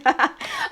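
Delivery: high embarrassed laugh through tears; teary laugh. Real, not acted. Embarrassed at crying in front of viewers, teary laugh. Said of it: "I wasn't planning on crying".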